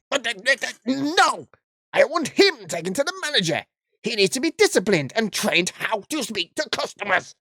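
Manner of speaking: hammed-up furious customer; mock sputtering outrage. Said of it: "I can't keep this voice up".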